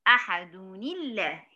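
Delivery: slow "ahaduni-llah" for أحد الله; joined with kasra. Shown as a demonstration. Teacher repeating the correct joining, linked with kasra. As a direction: glide from أحد into الله through "-ni". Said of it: "هحركها بالكسر".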